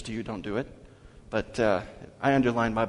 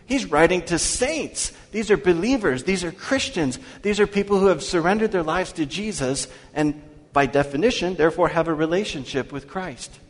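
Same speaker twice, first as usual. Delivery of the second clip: raised, higher insistent preaching; building pulpit emphasis; 3 semitones higher, 4 dB louder than usual. Acted. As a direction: press the point harder each line. Preacher builds his point.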